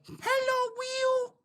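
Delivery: put-on very high, tiny little-kid voice. Imitating his stepson greeting him in a tiny child voice. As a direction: chirp it like a small French boy saying hello. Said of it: "the littlest, kiddest voice ever".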